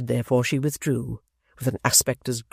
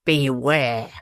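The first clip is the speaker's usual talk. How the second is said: mild lofty warning. A narrator's reading. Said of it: "said Mister Tappertit".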